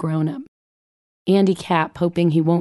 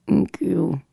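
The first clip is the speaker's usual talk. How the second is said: mumbled thanks. Retold or imitated. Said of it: "said it again out the back of her skull".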